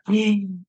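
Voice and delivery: sharp intake of breath; re-enacted relief gasp. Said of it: "breathed this relief".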